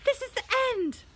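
high, panicked wail; despairing moan. A narrator's reading. Ron trapped as Filch approaches, high despairing wail. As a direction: wail as if all is lost. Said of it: "Ron moaned".

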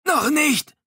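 very high, loud imploring cry; urgent pleading. A narrator's reading. Glass implores Dominique not to head for shore, loud urgent plea. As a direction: beg him hard over the rapids. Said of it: "beschwor Glass ihn".

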